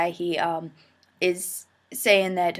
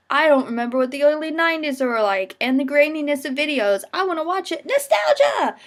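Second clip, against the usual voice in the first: excited viewer; 8 semitones higher, 5 dB louder than usual. Retold or imitated. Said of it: "or if you're like".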